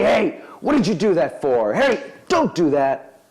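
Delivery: a parent playing angry at the child; mock angry scolding. Scolding the toddler who smashed the glass, mock angry. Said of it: "you get mad at him".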